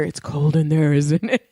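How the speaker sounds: low, voiced imitation of a creepy murmur; creepy whisper, retold. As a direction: lean in low, mock-creepy. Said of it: "creepily whispered in her ear".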